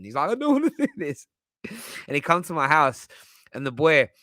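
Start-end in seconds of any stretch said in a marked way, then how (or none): 0.3-1.3 imitating crying friend. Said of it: "I remember him crying".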